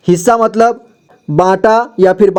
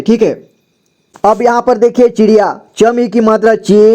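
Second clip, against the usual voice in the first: usual speaking voice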